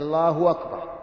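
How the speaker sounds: "الله أكبر" run together as Allāhu wakbar; hamza turned to waw. Shown as a demonstration. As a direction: say wakbar for "أكبر", no glottal stop. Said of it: "تقلب الهمزة المضموم ما قبلها واوا". (نطق "الله أكبر" بقلب الهمزة واوا)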